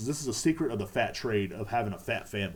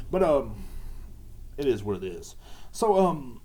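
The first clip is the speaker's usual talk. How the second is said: ordinary talk, nothing marked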